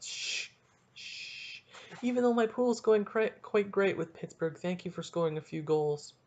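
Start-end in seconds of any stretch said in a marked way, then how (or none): 0.0-1.6 soft hissed shushing; mock silencing shh; drawn-out shush. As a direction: hush someone mid-complaint. Shushing Pittsburgh after shut up.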